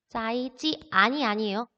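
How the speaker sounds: usual speaking voice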